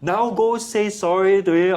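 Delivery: bossy command. Shown as a demonstration. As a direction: bark it like a strict teacher. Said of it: "Don't say".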